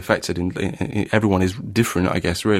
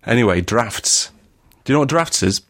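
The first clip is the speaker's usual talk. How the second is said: broad northern accent; 2 semitones higher than usual. Shown as a demonstration. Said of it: "broad northern accent".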